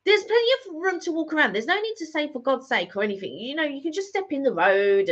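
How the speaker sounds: high, exasperated comeback to a rude stranger; telling off the man who tutted, voice pitched well up; indignant retort. Retold or imitated. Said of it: "And I was just like".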